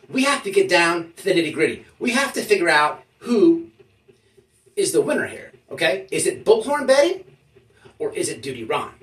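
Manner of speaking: ordinary talk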